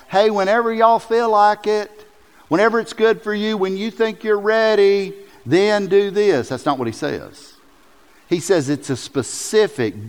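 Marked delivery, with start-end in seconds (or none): 0.1-6.5 mock casual. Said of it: "it's not a general call".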